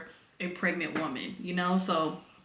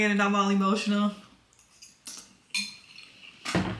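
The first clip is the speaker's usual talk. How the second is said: normal talking voice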